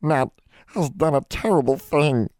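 high-pitched, moaning elf voice; high, pitiful elf moan; moaning house elf. A narrator's reading. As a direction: wail it mournfully as a small creature. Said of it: "Nat moaned".

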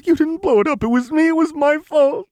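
very high, loud sobbing confession; tearful. A narrator's reading. Lux taking all the blame before Jinx, sobbing. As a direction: wail it through hot tears. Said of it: "tears fell in hot".

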